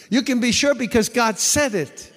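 high, loud, fast fiery preaching; shouted sermon climax, fast and driving; preaching at full force. Acted. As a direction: hammer it home like a revival preacher.

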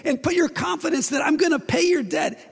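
God bursting with eagerness to rescue; excited God. Acted. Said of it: "He came excited to do it".

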